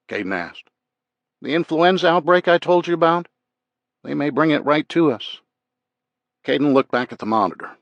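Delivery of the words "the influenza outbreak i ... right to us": higher, louder character voice for a doctor; worried doctor. Acted. Doctor warning officer about influenza outbreak, worried and higher. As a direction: voice the doctor warning, pitched well up.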